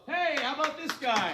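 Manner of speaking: loud, high-pitched hail; calling out. Real, not acted. Comic breaks off story to hail someone, loud call.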